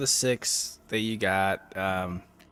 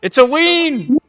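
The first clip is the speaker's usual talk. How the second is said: loud, high cry of triumph; excited shout; 11 dB louder, slower than usual. Real, not acted. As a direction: yell it out after a lucky roll. Excited dice roll win.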